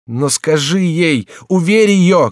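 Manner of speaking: high, slow, pleading shout; desperate loud appeal. A narrator's reading. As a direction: beg at the top of the voice. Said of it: "кричал Генрих".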